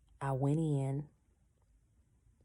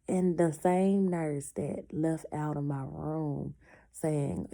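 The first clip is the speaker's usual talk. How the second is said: normal talking voice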